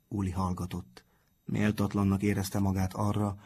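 plain reading voice

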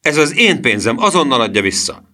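high, indignant demand; boy's cried demand. A narrator's reading. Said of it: "kiáltotta Emil".

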